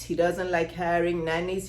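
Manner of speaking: ordinary talk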